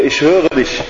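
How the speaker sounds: a hint of an unseen stranger answering; lightly voiced hidden companion, calm; eerie companion voice. The hidden companion answering the frightened girl, eerily. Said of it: "Er sagt zu ihr".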